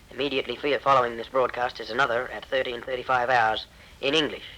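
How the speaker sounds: ordinary talk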